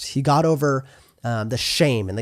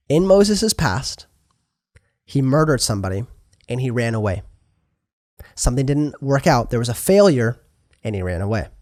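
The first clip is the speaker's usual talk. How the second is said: ordinary talk, nothing marked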